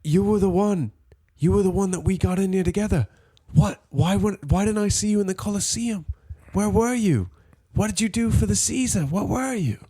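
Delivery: hammed-up interrogation, half laughing; mock worried friend. Rescued gladiator questioning his friend, high mock voice. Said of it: "I'm like".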